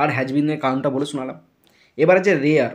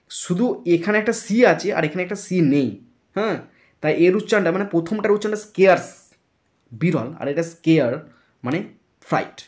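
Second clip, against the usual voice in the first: usual speaking voice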